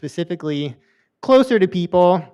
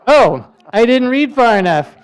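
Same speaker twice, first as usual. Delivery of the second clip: laughing; 3 semitones higher, 5 dB louder than usual. Real, not acted. Reading elephant dung label.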